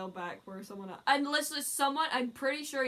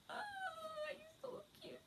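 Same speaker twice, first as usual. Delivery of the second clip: high-pitched squeal of delight; squealing fangirl; 14 dB quieter, faster than usual. Retold or imitated. Fan gushing over the idol's smile, high squeal.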